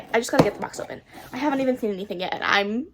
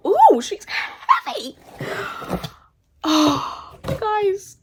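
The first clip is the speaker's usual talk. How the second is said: delighted, drawn-out; 9 semitones higher, 3 dB louder, much slower than usual. Real, not acted. Lifting the heavy book.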